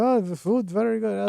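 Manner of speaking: mock Eastern European accent; broken English, heavy Russian accent. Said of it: "that is definitely not an Algerian accent".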